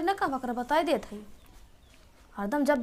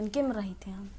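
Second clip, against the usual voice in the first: whispered aside; 7 semitones lower, 9 dB quieter than usual. Acted. Aside in quarrel.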